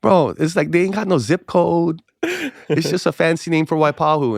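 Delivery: high, exaggerated protest; comic scoffing. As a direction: protest it high, playing for laughs. Comic scoffing at the church's location.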